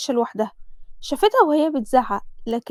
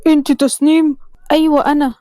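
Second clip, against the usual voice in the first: policeman; 6 dB louder than usual. Acted. Policeman questions the girl.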